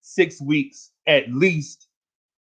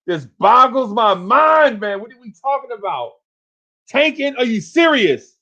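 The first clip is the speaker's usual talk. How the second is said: exasperated disbelief; 6 semitones higher than usual. Real, not acted. Ranting about tanking.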